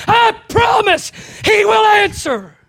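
high, loud and slow; shouted promise. Real, not acted. Preacher promising the congregation, slow loud high cry. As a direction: proclaim it with all your force.

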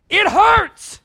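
usual speaking voice

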